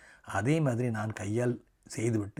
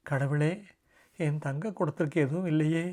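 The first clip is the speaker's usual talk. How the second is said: fretting nanny; 6 semitones higher, 4 dB louder than usual. A narrator's reading. Nanny fretting over sick child.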